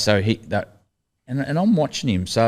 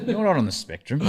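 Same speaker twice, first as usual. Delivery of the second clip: laughing; 8 semitones higher, 3 dB quieter than usual. Retold or imitated. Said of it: "he looks at me and goes".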